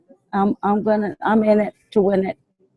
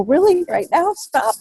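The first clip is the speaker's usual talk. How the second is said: amused mock protest; 8 semitones higher than usual. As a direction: tease a friend who keeps sending funny videos. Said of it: "I go".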